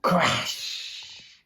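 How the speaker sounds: ordinary delivery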